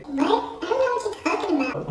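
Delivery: squeaky high mock-innocent voice; mock innocent denial. Imitating the friend who denies texting him, in a high innocent voice. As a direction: play dumb in a high put-on voice.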